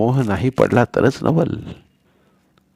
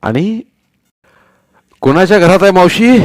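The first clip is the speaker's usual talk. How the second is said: put-on much higher, much louder roar of the old man; 9 semitones higher, 8 dB louder than usual. A narrator's reading. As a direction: thunder the question at the boys. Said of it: "Patil गरजताच".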